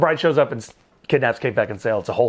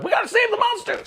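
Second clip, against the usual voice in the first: very high, mock frantic shout; shrill brides' cry. Brides seeing the carriage fall, mock frantic shout. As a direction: screech it like a panicked vampire bride. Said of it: "the brides are like".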